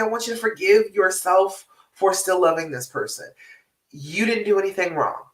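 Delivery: usual speaking voice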